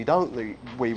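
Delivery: ordinary talk, nothing marked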